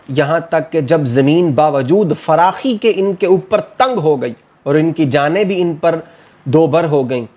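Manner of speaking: normal talking voice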